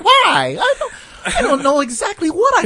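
high, fluttery mock old-Hollywood British accent; old-movie British accent. Mocking old movie actresses, in a high fake British accent. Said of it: "talk in that voice".

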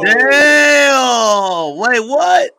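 drawn-out high, loud disbelief; shocked shout. Real, not acted. Co-host hearing she said it in front of him, shocked shout. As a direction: shout it high like you can't believe it. Reacting to disrespect in story.

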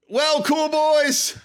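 high, slow host sign-off; announcer voice. Acted. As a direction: sing out the show sign-off. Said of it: "show sign-off voice".

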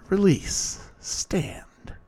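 cold command. A narrator's reading. Damien commands bully with power.

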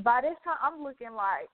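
ordinary delivery

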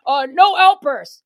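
loud, slow, stretched-out scolding; mock official order. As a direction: imitate a meeting official laying down the rule. Mimicking meeting officials' made-up rule.

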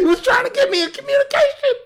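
squealing high through laughter; fast words squeezed out through real laughter; laughing hard. Real, not acted. Host cracking up at their own theory, laughing hard.

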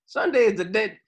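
high, loud sing-song preaching; mock preacher. Friend mocks a funeral preacher, high and loud. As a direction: ham it up like a church preacher.

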